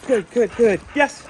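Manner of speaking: loud, high burst of praise; excited praise. Real, not acted. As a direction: reward the dog with a big happy cheer. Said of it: "get excited and get their reward".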